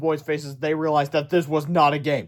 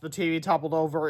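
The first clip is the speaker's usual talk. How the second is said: ordinary delivery, nothing marked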